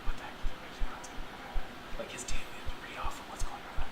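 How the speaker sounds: scandalized whispers among gossiping clerics; hushed, voiceless murmur of disbelief; whispering. Acted. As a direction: whisper it to the colleague beside you. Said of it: "You can just hear whisperings among them".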